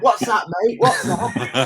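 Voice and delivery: mock shout out the window. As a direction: call out, straining to hear. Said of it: "shouting out".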